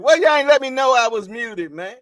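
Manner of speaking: high, loud, fast protest; indignant complaint. Real, not acted. Streamer scolding viewers for not flagging the mute, indignant. Complains nobody said he was muted.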